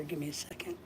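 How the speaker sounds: breathy whispered aside. Real, not acted. Whispered aside to the viewers while lifting the sick dog. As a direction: drop to a whisper, voice gone.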